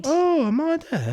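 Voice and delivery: high, slow bewildered wondering; mock dazed shock. Crashed driver seeing a star, pretend dazed shock. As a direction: play someone unsure they're alive.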